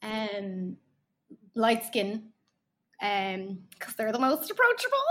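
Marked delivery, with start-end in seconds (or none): 3.8-5.1 wry laugh through the words. Real, not acted. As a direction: laugh at the irony. Sarcastic joke ending in laugh.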